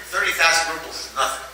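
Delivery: ordinary delivery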